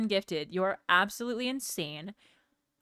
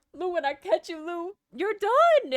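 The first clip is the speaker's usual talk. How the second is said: mock scolding, building; 9 semitones higher, 3 dB louder than usual.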